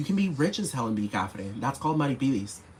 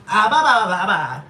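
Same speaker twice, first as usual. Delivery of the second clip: high, loud mocking baby babble with a laugh; mock her as silly and childish; 8 semitones higher, 6 dB louder than usual. Mocking [name]'s tweets as childish babble. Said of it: "She's like".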